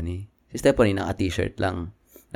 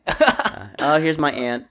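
higher, put-on posh English; mock British "here's my aunt"; 6 semitones higher, much slower than usual. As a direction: present your aunt like a snob. Mocking posh introduction.

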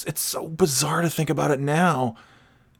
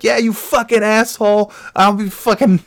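loud, high, cocky ranting; hammed-up swaggering taunts, pitched up; mock trash talk; 8 semitones higher, 4 dB louder than usual. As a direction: boast obnoxiously as a brash teenager. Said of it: "dropping F-bombs with this one news reporter".

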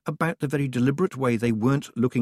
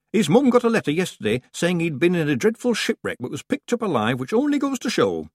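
high, eager gossiping villager; village woman; 9 semitones higher, 5 dB louder than usual. A narrator's reading. Village woman sharing the shipwreck news, high and eager. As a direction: chatter it out like a country gossip.

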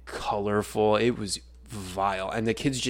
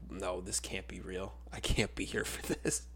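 much softer, half-whispered disbelief; horrified murmur; 2 semitones lower, 9 dB quieter than usual. Retold or imitated. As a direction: mutter it under your breath, appalled. Horrified inner thought.

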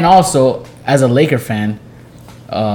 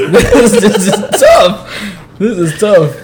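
drawn-out, high words through laughter; amused agonizing, laughing; laughing dismay; 7 semitones higher, 5 dB louder, much slower than usual. Real, not acted. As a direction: crack up over an impossible choice. Laughing over the hard dilemma.

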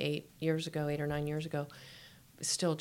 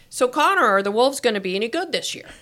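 hearty coach question; 6 semitones higher, 10 dB louder, faster than usual. Retold or imitated. Coach asking the teenage son.

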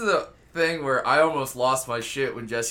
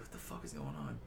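groggy mumble; 10 semitones lower, 16 dB quieter than usual. Acted. Girl woken by lights outside.

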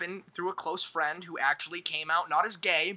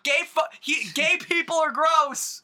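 high, loud, drawn-out shouting; raised voice, stretching the words; loud outburst; 7 semitones higher, 4 dB louder, slower than usual. Acted. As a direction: shout it out slowly, pitched up high. Mock outburst over friends' remarks.

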